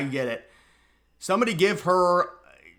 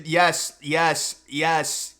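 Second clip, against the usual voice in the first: ordinary delivery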